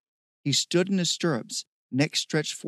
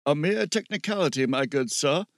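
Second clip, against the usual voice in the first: hammed-up formal gentleman voice; mock-grand gentleman. A narrator's reading. Steve grandly brushing off Bear's objection, mock-grand gentleman. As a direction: put on airs like a lordly benefactor.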